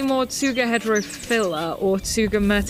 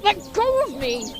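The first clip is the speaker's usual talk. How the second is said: struggling cry; 8 semitones higher than usual. Acted. Nerd grabbed by bully.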